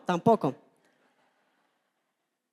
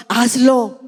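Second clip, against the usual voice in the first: louder, insistent hazlo with a falling end; urgent command; 4 dB louder than usual. Shown as a demonstration. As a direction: push hazlo out as a firm plea. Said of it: "hazlo. Hazlo".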